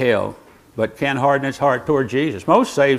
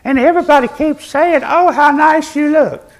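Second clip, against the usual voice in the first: high, sing-song imitation of a gushing churchgoer; light high-pitched mock of a flattering lady; mimicking a gushing woman; 10 semitones higher than usual. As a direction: gush it like the woman who dances around, pitched high. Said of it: "that woman that dances around and says".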